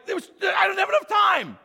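high, loud panicked protest; panicked driver shout. Retold or imitated. Driver shouting back at the GPS, panicked and loud. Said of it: "And you're like".

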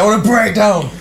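high, loud, laughing yell; excited shout. Retold or imitated. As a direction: yell it through a laugh, thrilled. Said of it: "I was just like".

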